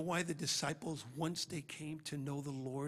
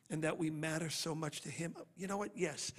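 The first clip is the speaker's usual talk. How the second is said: normal talking voice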